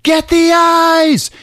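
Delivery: very high, loud shouted warning, slow at the end; mock yell. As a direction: shout it to a friend in danger. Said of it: "he yells out".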